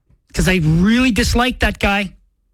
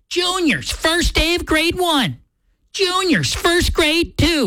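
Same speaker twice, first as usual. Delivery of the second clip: high, drawn-out parent announcement; slow, high-pitched caption voice; doting parent; 7 semitones higher, slower than usual. Acted. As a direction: lightly play a parent showing off a child. Voices parents' back-to-school photo captions.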